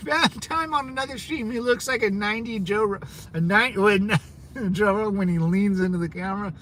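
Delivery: real amusement breaking into laughter; laughing while reading. Real, not acted. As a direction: read it out, cracking up midway. Cracks up rereading a viewer's comment.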